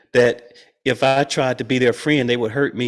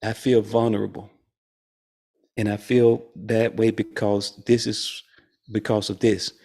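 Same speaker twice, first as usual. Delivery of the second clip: vulnerable admission; 4 semitones lower, 5 dB quieter than usual. Pretend. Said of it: "if we would just simply say".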